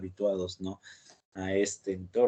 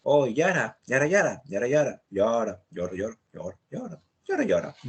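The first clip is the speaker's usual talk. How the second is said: mock Yara chatter; 3 semitones higher than usual. Profesor imitando una conversación en jerga Yara, cantarina. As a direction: babble "Yara" as if in a whole conversation. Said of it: "conversaciones enteras en Yara".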